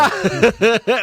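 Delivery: high shrieking laugh; loud laugh. Real, not acted. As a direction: burst out laughing. Reacts to crude joke.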